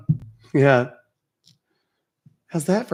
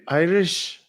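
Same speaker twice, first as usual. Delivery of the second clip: Irish accent; 3 semitones higher than usual. Shown as a demonstration. Names accent while doing it.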